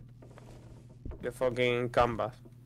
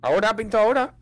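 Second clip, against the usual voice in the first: surprised; 9 semitones higher, 5 dB louder, faster than usual. Real, not acted. Reacting to the painting.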